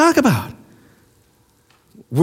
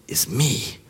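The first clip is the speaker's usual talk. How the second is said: whispered, much softer and lower; mock whisper; 10 semitones lower, 11 dB quieter than usual. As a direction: breathe it as a smug secret. Mocking the world's self-worship.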